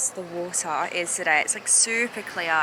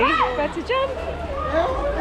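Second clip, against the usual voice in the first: excited; 6 dB louder, much slower than usual. Real, not acted. About to jump into water.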